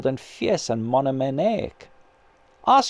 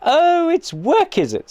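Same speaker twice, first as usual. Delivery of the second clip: high, slow, louder sneer; snapped sarcasm; 14 semitones higher, 4 dB louder than usual. A narrator's reading. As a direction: bite back with scorn at the word work. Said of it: "snapped the ghost".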